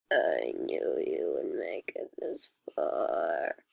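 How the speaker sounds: low, slow rasping whisper; creepy whisper. A narrator's reading. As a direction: breathe it like a lurking monster. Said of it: "The voice startled me".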